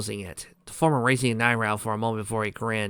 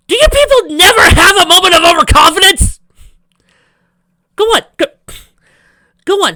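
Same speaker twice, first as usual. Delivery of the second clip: loud taunting rant; 12 dB louder than usual. Real, not acted. Reader daring commenters to admit overconfidence, loud high rant. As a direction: challenge the viewers, shouting.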